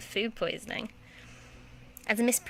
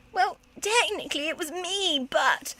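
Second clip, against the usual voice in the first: higher, breezy confession; cheeky girl; 8 semitones higher than usual. Acted. Petula owning up to the museum director. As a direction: own up with a cheeky lilt.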